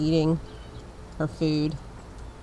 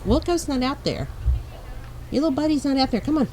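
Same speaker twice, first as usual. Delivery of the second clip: talking to the dog; 8 semitones higher, faster than usual. Real, not acted.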